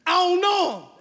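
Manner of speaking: high, loud oath; swearing shout. Retold or imitated. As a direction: shout the oath to convince them. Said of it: "So now this man was like".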